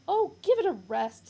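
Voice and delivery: loud, high fed-up retort; exasperated. Acted. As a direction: brush off the guard. Ford fed up with the guard.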